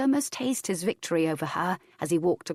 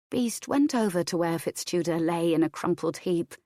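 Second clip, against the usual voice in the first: ordinary delivery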